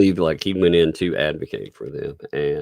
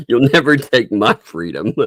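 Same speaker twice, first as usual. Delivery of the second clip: Braveheart speech; 11 semitones higher, 5 dB louder than usual. Pretend.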